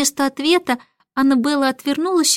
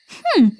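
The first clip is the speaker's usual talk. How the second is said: short, higher hummed snort; sceptical hm; 6 semitones higher than usual. A narrator's reading. Nanny answers the boastful starling with a sceptical hm. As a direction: hum a brief dismissive reply. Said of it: "хмыкнула в ответ Мэри Поппинс".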